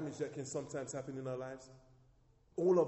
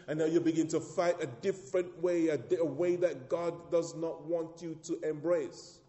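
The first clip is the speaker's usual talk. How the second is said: loud, ringing preaching to the church; full-force preaching; 2 semitones higher, 9 dB louder than usual. Acted. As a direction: preach it out, hammering each phrase.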